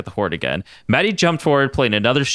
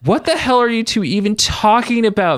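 exasperated Maddie; 12 semitones higher, 3 dB louder than usual. A narrator's reading. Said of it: "Maddie asked".

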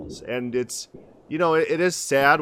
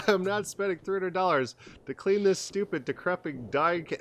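laughing refusal; 7 semitones higher, 4 dB quieter than usual. Retold or imitated. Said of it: "I'm like".

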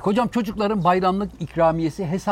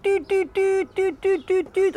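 high horn beeps. Retold or imitated. Imitating a car horn.

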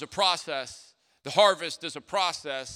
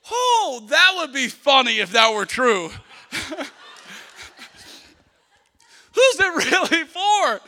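much higher and louder, laughing through it; laughing cake lady; 10 semitones higher, 11 dB louder, slower than usual. Retold or imitated. Bakery lady laughing at the pastor's cake message, high and loud. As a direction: cackle it out like the clerk can't believe it. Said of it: "she starts dying laughing".

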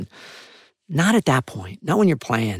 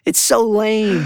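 high, loud, drawn-out mock complaint; higher, exaggerated kid protest; imitating daughter; 9 semitones higher, 5 dB louder, slower than usual. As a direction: mimic a child's slow grumble. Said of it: "my daughter always goes like".

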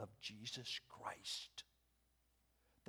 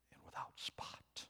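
hushed whisper; 9 dB quieter than usual. Acted. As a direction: whisper and without spot. Preacher drops to a whisper.

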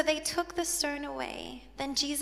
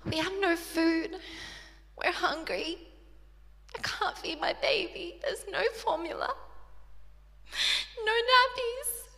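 pleading friends; 5 semitones higher, 3 dB louder than usual. Retold or imitated. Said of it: "messages from my friends saying".

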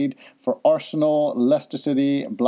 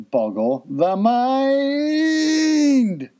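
slow, drawn-out rising flourish; hammed-up catchphrase; 11 semitones higher, much slower than usual. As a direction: stretch the last word out theatrically. Echoing the segment title theatrically.

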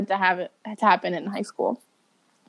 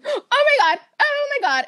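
a teacher horrified at the mess; shocked teacher. Retold or imitated. Teacher reacting in retold story.

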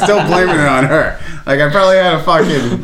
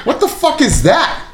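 mock shocked; 10 semitones higher than usual. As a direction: fake surprised outburst, pitched up. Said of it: "and I'm like".

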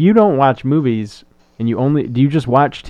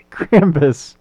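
ordinary delivery

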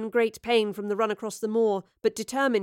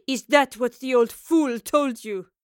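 loud, slow scornful question; mocking villain, drawn out and loud; derisive; 2 semitones higher, 7 dB louder, slower than usual. A narrator's reading. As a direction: sneer at their foolishness. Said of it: "Lorelei laughed derisively".

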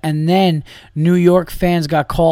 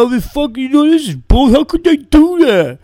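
much higher, louder mocking voice of a meathead fan; whiny pizza-eating fan, pitched way up and loud; dumb fan impression; 12 semitones higher, 7 dB louder than usual. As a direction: ham up a dumb loudmouth fan with a mouth full of pizza. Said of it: "the guy who just has a slice of pizza in his face, go".

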